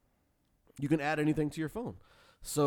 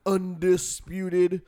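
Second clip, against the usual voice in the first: ringmaster; 5 semitones higher, 7 dB louder than usual. Acted. Announcer build up.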